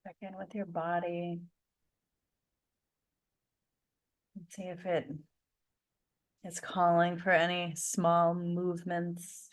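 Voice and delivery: soft, unhurried meditation voice with long pauses; guided meditation. Acted. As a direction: gently guide the group to settle, leaving space between phrases.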